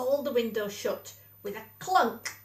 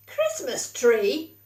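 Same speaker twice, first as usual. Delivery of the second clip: slow, louder indignant splutter; 8 dB louder, much slower than usual. A narrator's reading. Badger spluttering at Mole's request, indignant. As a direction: splutter it in disbelief. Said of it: "spluttered Grumpy Badger".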